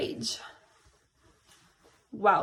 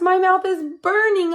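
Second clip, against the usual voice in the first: higher, louder mock yell; mild mock yell; 7 semitones higher, 5 dB louder than usual. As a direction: yell about the burning mouth. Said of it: "I yelled".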